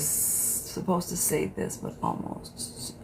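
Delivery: whispered, slow confession; secretive whisper. Real, not acted. A viewer confessing on the live stream, whispered. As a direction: let it slip out in a hush.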